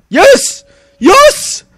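very high, loud victory shout; triumphant yell. Real, not acted. Gamer celebrating his win, high loud cheering. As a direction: scream yes in triumph.

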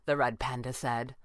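plain reading voice

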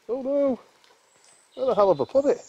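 mock alarm at a lost bottle; startled panic. Real, not acted. Can't find the beer.

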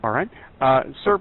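usual speaking voice

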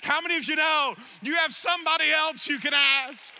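rally the congregation; preaching full force. Acted. Pastor calls out to the congregation, loud and fervent.

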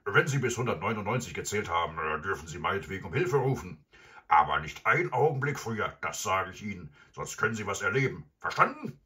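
loud, rapid villain warning; threatening robber. Acted. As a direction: rattle off orders loudly, ending high. Robber figure threatening in story.

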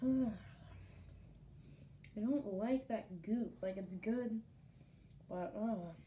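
grimacing from the taste; just after a drink. Real, not acted. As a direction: wet mouth after sipping. Seen 2: Just sipped from green cup; holds it, grimaces while talking.

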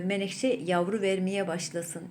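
normal talking voice

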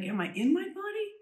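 sudden high startled question; startled am I in my body; astonished question. Retold or imitated. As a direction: ask it in disbelief, pitch leaping up.

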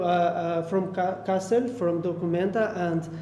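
ordinary delivery

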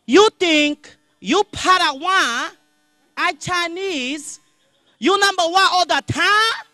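high, slow, loud mock Chinese accent; replaying the shop lady's words in slow motion, mock Chinese accent. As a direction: replay her line slowly in a put-on Chinese accent. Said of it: "realized what she said".

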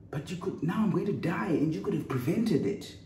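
high, desperate accusation; frantic accusation. Pretend. Bitten victim accusing the silent friend, high desperate pleading. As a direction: blame the friend as if dying. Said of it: "you might say something like".

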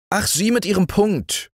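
high, scoffing ant voice. A narrator's reading. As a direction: brush off the beetle as the ant. Ant dismissing beetle.

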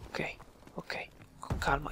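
near-whisper, very soft; barely voiced, calming yourself down; hushed. Real, not acted. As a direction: whisper it to settle your nerves. Hushing himself after screaming at night.

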